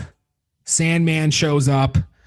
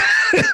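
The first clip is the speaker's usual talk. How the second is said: burst of high laughter. Real, not acted. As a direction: let a high cackle burst out. Laughing at own joke.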